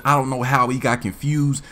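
normal talking voice

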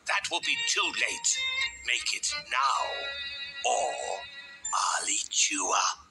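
menacing big bad wolf voice; threatening wolf. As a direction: order it, then threaten to eat him.

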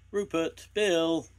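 high, loud call. A narrator's reading. Hidden girl calling to Rupert and Bill, calling out. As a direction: call out to friends from a distance.

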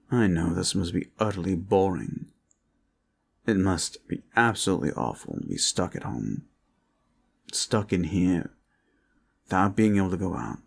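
usual speaking voice

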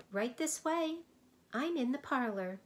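high, lilting welcome voice; gentle sing-song call. A narrator's reading. Soundkeeper calling Milo to the parlor, high and lilting. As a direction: sing it out sweetly from another room. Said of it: "a gentle voice sang out".

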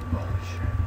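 unvoiced shocked whisper; whispered curse. Real, not acted. Player hiding from the monster, whispered curse. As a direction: breathe the curse out silently.

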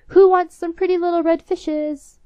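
high, loud street hawker's call; peddler's cry. Acted. Old peddler calls under the princess's window, hawker's cry. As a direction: call your wares down the street. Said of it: "he began to cry out".

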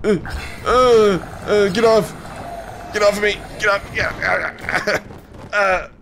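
high, loud frantic yelling; terrified horror victim. Real, not acted. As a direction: shriek to shake it off. Monster attacking her.

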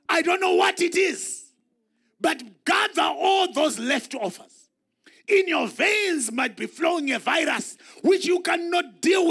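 preacher shouting to stir the church; full-force preaching. Acted. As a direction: preach it out loud to the congregation.

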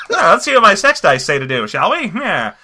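hammed-up gangster, half laughing; goofy old-time gangster impression; Quentin Tarantino. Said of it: "he sounds like an old gangster".

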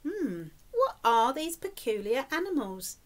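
usual speaking voice

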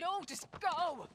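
loud, slow, pleading yell; desperate shout. Acted. Tess yelling at Joel and Ellie to run, desperate shout. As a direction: drive them out the door. Soldiers coming, Tess sends them off.

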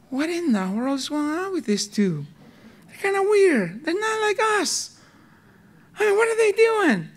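gossiping like puzzled onlookers in heaven; puzzled angels. Retold or imitated. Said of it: "and they're like".

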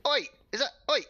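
loud, high "oi!" at an intruder; sharp call to get attention. Real, not acted. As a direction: shout oi across the site. Calling out at intruder.

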